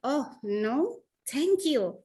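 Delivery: light, smiling no thank you; friendly refusal. Shown as a demonstration. Teacher showing students a kind, smiling refusal. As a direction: decline gently with a smile. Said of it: "kalian bisa ucapkan dengan ekspresi".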